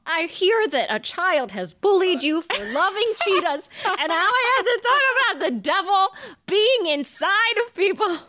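laughing-crying, overwhelmed; teary. Real, not acted. Overwhelmed, near tears.

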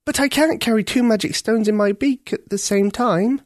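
put-on high, earnest young girl; a girl pleading to save the prince. A narrator's reading. Ketcha voiced in story.

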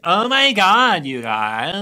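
put-on a high, loud, slow Valley Girl gush. Brits falling back on a Valley Girl accent, gushing. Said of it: "that's what they think Americans all sound like".

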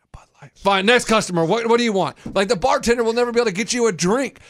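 normal talking voice

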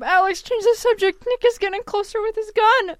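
high, loud pretend plea; mock panic. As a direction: beg for rescue urgently. Pretend held at gunpoint.

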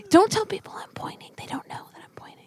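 hushed, whispered plea to keep it between you; mock-secret whisper. Podcaster to co-host who exposed their pointing, playful whisper.

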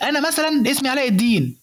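high, punchy comic reveal of the name; good opener delivery. Shown as a demonstration. Coach demos the good way to open a joke, high and punchy. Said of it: "تعالى بقى بعديها أقول لك".

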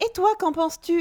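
loud, high cartoon-host question; Dora creepy. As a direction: put on an over-bright, creepy kids' show heroine. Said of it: "c'est hyper creepy quand elle fait". (imitation appuyée d'une Dora flippante)

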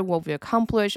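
ordinary talk

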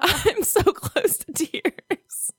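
high, slow words squeezed out through laughter; laughing so hard it nearly turns to crying; teary, breathless laughter; laughing to tears. Real, not acted. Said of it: "Are you crying right now?".

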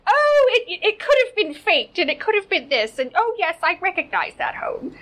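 high, loud, stammering mimicry of a bad liar; mock flustered denial. Vlogger mimicking the brother's denials about the photograph, mock flustered.

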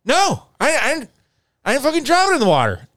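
high, loud indignant denial; imitating Corey. Corey denying he dropped the bag, high indignant snap. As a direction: snap back defensively. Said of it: "Corey denies".

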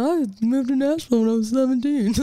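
put-on sound bite for the press, timbre changed; mock press-interview voice. Singer mocking her own Billboard interview answer, put-on press voice. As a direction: play up a boast for an interviewer. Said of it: "it sounds good for press".

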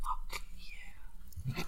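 whispered curse under the breath. Real, not acted. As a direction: breathe it out unvoiced. Muttered reaction.

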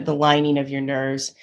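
normal talking voice

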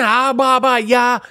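brushing-off nonsense syllables; mocking babble. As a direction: drown out their excuses with mock babble. Cuts off friends with mocking babble.

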